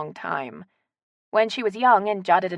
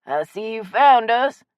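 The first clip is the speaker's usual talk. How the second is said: loud, slow Southern shopkeeper; hearty, raised voice with stretched words; friendly shopkeeper; 5 semitones higher, 8 dB louder, slower than usual. Acted. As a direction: drawl it out to welcome a customer.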